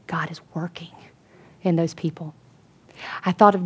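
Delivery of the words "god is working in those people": hushed reverent whisper; awed whisper. Retold or imitated. Worker marvelling to the preacher, whispered. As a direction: whisper it with wonder.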